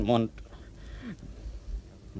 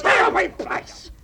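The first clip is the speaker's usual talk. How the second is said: shout; 7 dB louder than usual. Acted. Threatening man with weapon.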